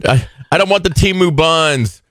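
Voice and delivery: put-on high whiny child voice; whiny kid. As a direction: whine like a hungry kid. Same kid voice.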